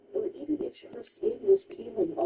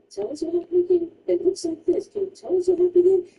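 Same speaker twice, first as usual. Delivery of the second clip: building to a louder, faster demand; insistent louder questioning; 3 semitones higher, 8 dB louder than usual. Retold or imitated. Staff demanding the story again, louder and faster. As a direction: press harder each time. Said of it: "they will ask you multiple times".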